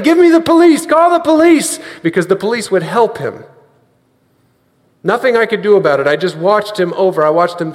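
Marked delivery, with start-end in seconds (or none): 0.0-1.8 imitating a desperate yell, high and loud; retold yelling. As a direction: cry out for help as the victim. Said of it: "and he was yelling".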